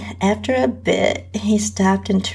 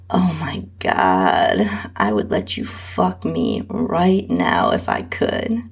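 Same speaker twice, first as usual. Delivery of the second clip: breathy, winded whisper of wanting; breathing hard, half-whispered; breathless; 10 semitones lower than usual. A narrator's reading. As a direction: gasp it out, trying to catch your breath. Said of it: "while I tried to get my breathing under control".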